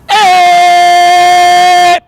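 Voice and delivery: very long, high, all-out scream; uninhibited sustained "eh" yell; full-force yell. Shown as a demonstration. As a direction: let rip at full volume and hold it. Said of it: "then I'll do, and I'll go".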